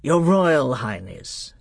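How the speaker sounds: put-on slow, icy king addressing a child formally; menacingly drawn-out title; stern royal formality; Miraz.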